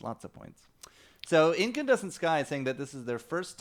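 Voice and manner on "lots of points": whispered repeat under the breath; whispered echo. Shown as a demonstration. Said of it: "Still have several weeks".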